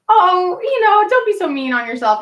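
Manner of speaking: high, sweet consoling voice; mock sympathetic inmate. Inmate in the jail shower comforting her, high sweet voice. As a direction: coo it like a kind inmate.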